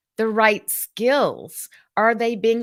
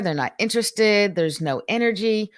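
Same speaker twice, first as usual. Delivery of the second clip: ordinary talk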